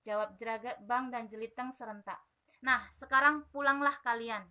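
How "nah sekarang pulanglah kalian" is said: king's order. Acted. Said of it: "kata Raja Jangkrik".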